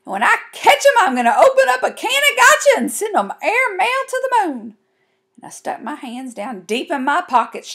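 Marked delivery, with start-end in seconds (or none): 0.0-4.8 high, loud wailed threat; crying hard. A narrator's reading. Girl vowing revenge to her family, wailing. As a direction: wail the threat through mock tears. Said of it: "crying harder than ever".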